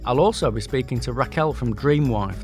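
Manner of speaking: ordinary talk, nothing marked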